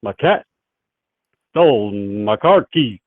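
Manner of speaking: halting, loud bad impression with drawn-out words; badly faked celebrity voice, stopping and restarting; Christopher Walken. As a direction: punch it out in uneven, halting bursts. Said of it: "I can do it like a Christopher Walken".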